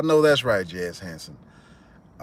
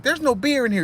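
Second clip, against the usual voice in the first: much higher, whiny complaint; complaining kid; 12 semitones higher than usual. Retold or imitated. The cousin at the freezer, high whiny voice. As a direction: grumble while digging. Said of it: "he was like".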